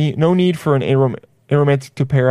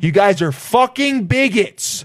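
higher-pitched mock outrage, slowing for emphasis; riled up; 8 semitones higher, slower than usual. Comic defending non-dairy eaters against shaming, riled-up mock outrage. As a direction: rant in playful indignation, stretching the words. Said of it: "I'm getting riled up".

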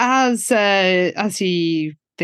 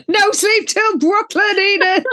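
laughing cheer; 10 semitones higher than usual. Real, not acted.

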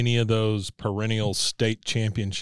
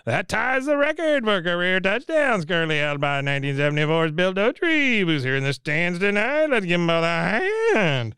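higher, booming stadium announcer read; 5 semitones higher than usual. Retold or imitated. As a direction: announce it over the stadium speakers. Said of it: "you hear the announcer again say".

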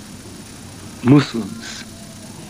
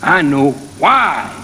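preaching. Acted. Minister preaching on patience to the congregation, rising then slowing.

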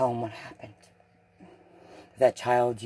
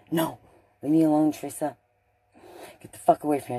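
loud, angry push-away, louder and higher; angry outburst; 4 semitones higher, 6 dB louder than usual. Retold or imitated. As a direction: snap at her to leave you alone. Josh crying, told to leave.

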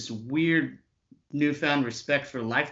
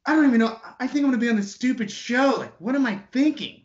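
much higher, louder and fast, spiralling panic; hysterical panic; 11 semitones higher, 5 dB louder, faster than usual. Retold or imitated. Panicking after turning down a massive job, hysterical. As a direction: rattle it off as you freak out. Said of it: "I start getting hysterical".

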